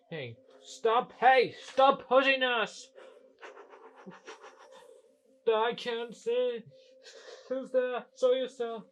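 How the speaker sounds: high, loud pretend protest; alarmed shouting. Engine pushed by someone it can't see, alarmed shout. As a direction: call out in alarm at an unseen pusher.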